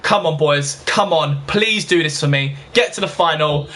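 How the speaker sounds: normal talking voice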